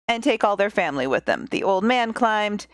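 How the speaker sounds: ordinary delivery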